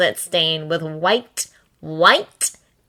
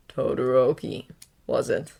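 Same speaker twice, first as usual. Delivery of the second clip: low, slick menacing voice; sinister villain voice; 6 semitones lower, 6 dB quieter than usual. A narrator's reading. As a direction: purr it like a killer. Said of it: "Stain's voice is as slick as oil".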